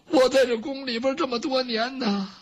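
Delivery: plain reading voice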